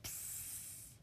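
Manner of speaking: unvoiced little "psst" hiss; soft, drawn-out hissed psst; baby's psst noise. A narrator's reading. Narrator makes the noise of the baby in the pram, soft psst. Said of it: "It said".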